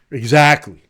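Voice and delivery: ordinary talk, nothing marked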